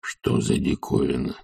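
low, slow husky murmur; hushed servant's bewilderment; puzzled thought. A narrator's reading. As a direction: ponder it to yourself. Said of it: "думал Захар".

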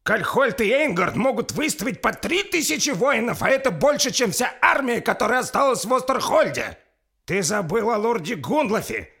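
loud, very high heated tirade; vehement rebuke. Acted. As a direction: tear down his claim point by point. Лорд громко отчитывает самозванца. (горячая гневная отповедь)

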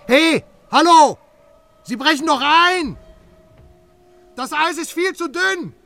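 high, loud calls across the ice; frantic calling out over a frozen lake; alarmed shout. Acted. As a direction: yell a warning to someone far off.

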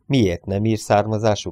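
plain reading voice

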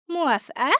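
put-on "موافقة" asked in a confident ghost girl voice, falling at the end. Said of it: "وقالت بمنتهى الثقة".

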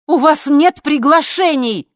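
high, slow, very loud shout; furious drawn-out yell; screaming. A narrator's reading. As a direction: shout it at gatecrashers. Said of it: "закричала Лина".

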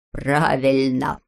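low, slow mocking agreement; low, drawn-out irony; wry amusement. A narrator's reading. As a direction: agree slowly with a dry smirk. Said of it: "усмехнулся Знайка".

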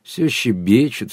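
slow, drawn-out contented teasing. A narrator's reading. Sergei teasing the chattering women, slowly and contentedly. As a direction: stretch it out with satisfied indulgence. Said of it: "сказал удовлетворённо".

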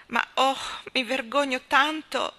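high, loud, drawn-out anguish; slow, pained outburst of shame; ashamed lament. A narrator's reading. Leslie admits her shame to Anna, anguished. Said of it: "mormorò Leslie".

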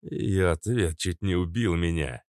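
usual speaking voice

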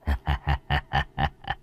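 low, soft sinister chuckle; a quiet gloating laugh; creepy low snicker; evil chuckle. Acted. Villain laughs over corpse.

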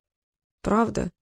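a soft dejected question; quiet, let-down pravda; disappointed. Acted. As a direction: let the hope sink. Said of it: "огорчился Трикс".

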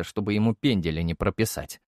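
plain reading voice

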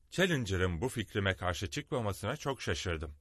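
usual speaking voice

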